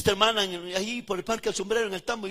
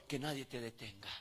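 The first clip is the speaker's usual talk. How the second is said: much softer, lower, falling repeat; quiet repeat; 7 semitones lower, 11 dB quieter than usual. Shown as a demonstration. Preacher repeating his charge to the congregation, much softer. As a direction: drop it down to a hush. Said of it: "repeated exhortation, softer take".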